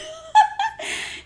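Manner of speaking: high giggling laugh; burst of laughter. Real, not acted. Laughing at hostess story.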